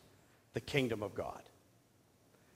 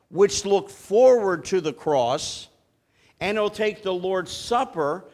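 preaching at full force; 5 dB louder than usual. Acted. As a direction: lift the congregation toward the promise, louder. Preaching climax, rising force.

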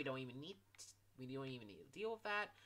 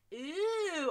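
disgusted squeal; 13 semitones higher, 8 dB louder than usual. Real, not acted.

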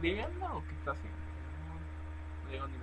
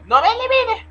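súplica chillona; 17 dB louder than usual. Acted. Suplicando al desconocido.

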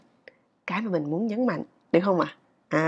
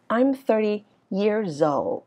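put-on slow "I'm thirty years old", gliding down on "old"; falling statement tune; much slower than usual. Shown as a demonstration. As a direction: let "old" drop at the end, spoken slowly. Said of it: "thì nó sẽ đi xuống". ("old" xuống giọng cuối câu)

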